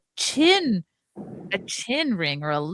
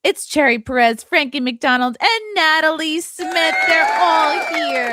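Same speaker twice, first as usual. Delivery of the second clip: excited announcing; 8 semitones higher, 5 dB louder than usual. Real, not acted. Announcing the stream guests.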